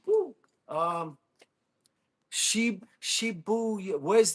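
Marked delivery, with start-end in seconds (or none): 0.0-0.3 excited whoop. Real, not acted. Excited cheer on stream.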